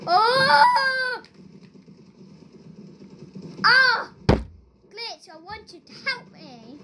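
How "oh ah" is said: high, loud startled cries; loud surprised yelps. Real, not acted. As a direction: yelp out in surprise. Game surprise.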